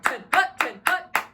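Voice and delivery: commanding call. Shown as a demonstration. Drum major candidate demonstrates calling the band to attention, commanding call. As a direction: bark the command with HUT jumping up. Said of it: "I can't do it to the full volume".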